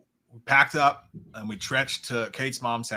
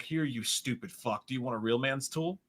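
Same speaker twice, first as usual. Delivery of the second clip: gruff neighbour voice; 8 dB quieter than usual. Retold or imitated.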